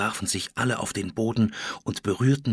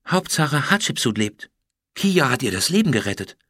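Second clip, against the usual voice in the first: ordinary delivery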